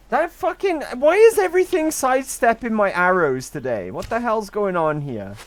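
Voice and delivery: loud, high, exasperated outburst; frustrated rant. Real, not acted. Streamer yells at enemies dodging his arrows, loud and fed up. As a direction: vent at the game, full volume.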